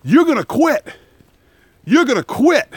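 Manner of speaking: screaming instructor. Retold or imitated. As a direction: scream it down at the recruit. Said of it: "they kept screaming".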